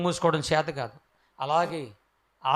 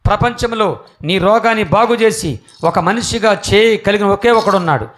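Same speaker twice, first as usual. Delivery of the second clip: louder, higher preaching at full force; fervent preaching; 5 semitones higher, 11 dB louder than usual. Acted. Preacher proclaiming Jesus' name to the crowd, loud and fervent. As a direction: proclaim it to the congregation with fire.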